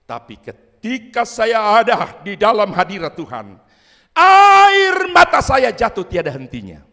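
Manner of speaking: slow, loud testimony rising to a high shout; kesaksian berapi-api. Retold or imitated. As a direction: cry it out as an overwhelmed testimony. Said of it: "Baru dia bilang apa?". (bersaksi lantang, memuncak jadi teriakan)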